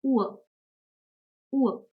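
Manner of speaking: "Uhr" as oo-ah, vocalic r; German example word. Shown as a demonstration. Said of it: "For example".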